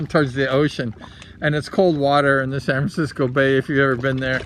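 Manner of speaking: ordinary delivery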